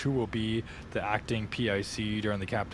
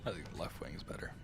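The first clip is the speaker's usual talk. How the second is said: whispering; 4 semitones lower, 12 dB quieter than usual. Real, not acted. Aside to friends in sim.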